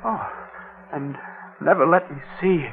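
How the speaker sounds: quiet. Acted. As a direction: murmur it, holding back. Quiet start before outburst.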